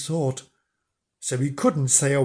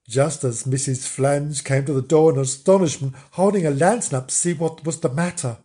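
usual speaking voice

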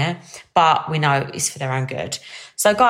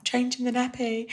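sheepish, softer, higher little reply; 6 semitones higher, 7 dB quieter than usual. Retold or imitated. Caught by her husband, answering sheepish and soft. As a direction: mumble it like a caught-out kid. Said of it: "I'm like".

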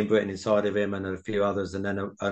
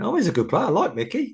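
much higher, innocently pleased reaction; mock-innocent enthusiasm, pitched right up; naive approval; 11 semitones higher than usual. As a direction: play the clueless young lad, delighted at the news. Naively pleased reaction, before penny drops.

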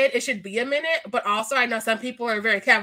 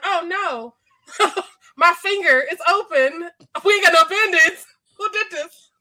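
hammed-up panic, louder and higher; mock panic; 4 semitones higher, 6 dB louder, slower than usual. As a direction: play the clumsy friend freaking out over a bleeding finger. Mock panic over cut finger.

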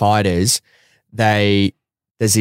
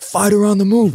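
high-pitched announcing call, mimicked; imitated yell; 11 semitones higher than usual. Staffer calling out the next fighter, mimicked yell. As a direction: mimic a staffer hollering down a hallway. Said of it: "yells at the top of his lung".

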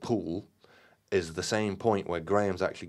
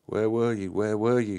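higher, louder, close to tears; croaked tearfully; 4 semitones higher, 5 dB louder than usual. Acted. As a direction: plead with the friends who left him, near crying. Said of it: "he croaked tearfully".

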